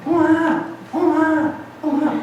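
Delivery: put-on high wailing baby voice calling Oma; mother telling how her crying baby called for grandma, in a high wail.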